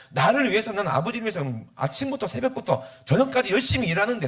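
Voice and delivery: high-pitched, heated complaint; aggrieved elder son. Acted. Elder son reproaches father over the feast, aggrieved. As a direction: protest the unfairness to your father. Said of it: "기분이 나빠갖고 막".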